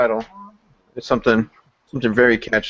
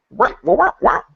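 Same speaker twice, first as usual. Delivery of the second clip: mock whining wah; 12 semitones higher than usual. Mocking whine.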